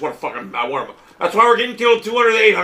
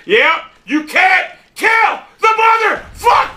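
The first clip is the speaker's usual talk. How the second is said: furious drawn-out yell; 10 semitones higher, much slower than usual. Real, not acted. Gamer yelling about an unkillable enemy, slow high yell. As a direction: stretch the yell out in rage.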